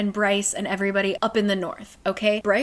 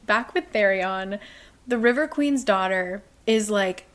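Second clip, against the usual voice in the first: usual speaking voice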